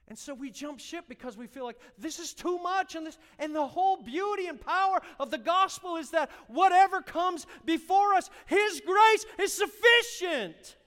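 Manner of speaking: fiery pulpit preaching; preaching at full force. Acted. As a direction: preach it hard.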